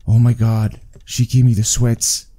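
usual speaking voice

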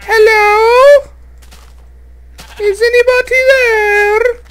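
piercing falsetto damsel, wailing; hammed-up falsetto helpless noob; helpless girl voice. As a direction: play the lost damsel in distress. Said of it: "I gotta change my voice".

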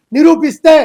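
a sudden loud dare; loud challenge. Real, not acted. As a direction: punch the word out loud and defiant. Daring critics to prove charge.

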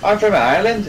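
a hint of a thick Northern Irish accent. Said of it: "it sounded thick and Irish".

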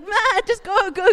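high, loud pleading at the lamb; yelling at a lamb heading for the cliff edge; pleading shout. Retold or imitated. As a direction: beg the lamb to turn back, loud and high. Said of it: "trying to talk lamb to it".

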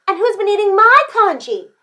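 high, loud Mama Bear style cry; indignant storybook mom; crying-out mother. A narrator's reading. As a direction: echo the outrage in a high voice. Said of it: "cried Missus Chan".

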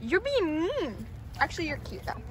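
usual speaking voice

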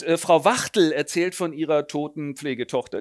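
ordinary talk, nothing marked

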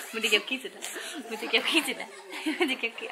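giggling tickle threat; playful laughing. Real, not acted. Mother playfully tickling toddler.

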